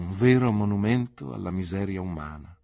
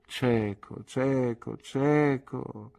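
sad repetition; 5 semitones higher, much slower than usual. Acted. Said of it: "con un triste sorriso".

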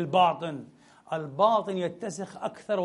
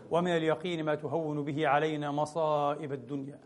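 steady, lower supplication chant; closing prayer; 4 semitones lower, 4 dB quieter than usual. Acted. Preacher leading the congregation in closing supplication, low and steady. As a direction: pray humbly and evenly for all.

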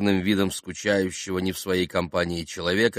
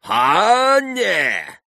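very high, loud warning cry; threatening shout; 8 dB louder than usual. A narrator's reading. Both gentlemen rounding on Mitya, shouting menacingly. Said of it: "прокричали оба пана с угрозою".

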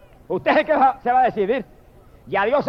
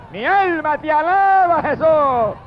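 higher, slow, ringing praise to Jesus; soaring praise cry; 5 semitones higher, slower than usual. Real, not acted. Preacher breaking into worship before the church, soaring higher cry. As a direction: let it rise like worship breaking out. Ecstatic praise burst in sermon.

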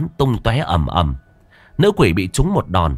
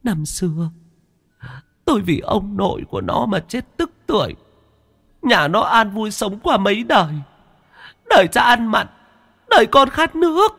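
much higher, eerie, tearful lament; wailing ghost woman; 13 semitones higher than usual. Acted. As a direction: mourn the old wrong like a weeping ghost.

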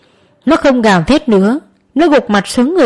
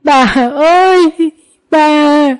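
anguished scream; 6 semitones higher, much slower than usual. A narrator's reading. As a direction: cry out as grandma falls. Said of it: "Nam hét lên". (hét lên đau đớn, kéo dài)

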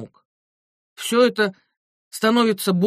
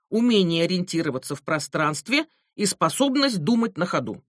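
plain reading voice

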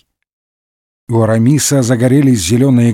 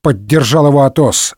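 ordinary delivery

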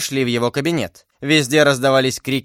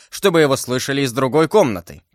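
ordinary delivery

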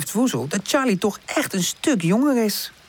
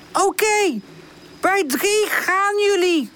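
high, slow, stretched call; high-pitched drawn-out shout; 13 semitones higher, slower than usual. A narrator's reading. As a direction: announce the countdown from afar. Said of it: "roept Pip".

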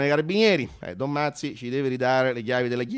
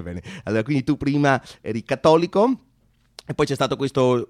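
usual speaking voice

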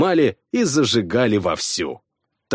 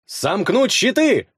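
slow, higher barked command to the shield wall; yelled order; 8 semitones higher than usual. Acted. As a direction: bark the order at your men. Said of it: "заорал я".

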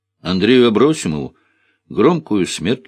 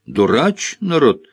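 usual speaking voice

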